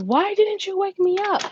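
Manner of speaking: high-pitched exasperated question; exasperated demand. Retold or imitated. Retold scolding of her son.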